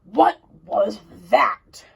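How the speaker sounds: slow, loud giant voice; giant's cry. Acted. Mister Giant hearing Jack in the barrel, slow loud cry. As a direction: bellow it out slowly. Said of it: "cried the giant".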